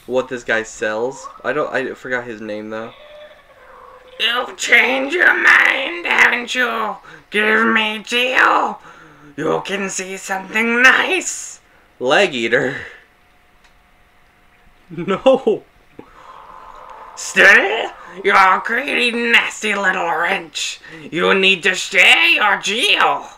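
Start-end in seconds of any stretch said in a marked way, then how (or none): 4.2-11.6 high, drawn-out merchant voice; slow, high-pitched game-creature haggling; Leg Eater. Acted. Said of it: "I can hear a little bit of Sly coming out of me there". 14.9-23.2 high, loud, scolding merchant voice; pushy game creature, pitched way up; Leg Eater. Acted. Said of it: "I can hear a little bit of Sly coming out of me there".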